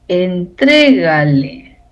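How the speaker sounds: "entrégale" drawn out slowly, stressed on TRÉ. Shown as a demonstration. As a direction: lean on the second syllable of "entrégale". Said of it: "requiere tilde porque es esdrújula".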